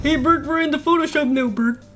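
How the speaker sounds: put-on puppet voice; chatty puppet talking to a pal; goofy puppet impression; Ernie. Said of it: "speak in the Ernie voice".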